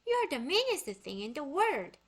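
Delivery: loud, high furious shout; outraged yell; angry shout. A narrator's reading. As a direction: scream it at the monkey. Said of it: "Annie shouted".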